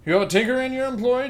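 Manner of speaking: high, quick and loud James. Acted. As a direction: pitch up and ask brightly. James voiced in read dialogue.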